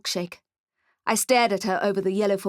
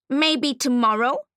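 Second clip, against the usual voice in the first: slow, higher teasing goblin; sly goblin voice; 4 semitones higher, 4 dB louder, slower than usual. Acted. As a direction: drag it out slyly as the goblin.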